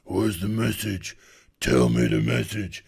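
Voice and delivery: low, flat mock voice of the heroine; lightly voiced demanding woman; mock demanding Kate. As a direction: growl it out like a bored bully. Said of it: "and she's like".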